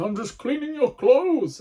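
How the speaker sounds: high, small hurt voice; innocent washing machine. Acted. Washing machine answering the shouting comedian, high and meek. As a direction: play the blameless machine meekly.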